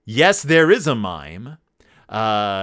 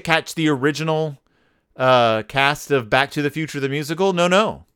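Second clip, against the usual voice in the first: ordinary delivery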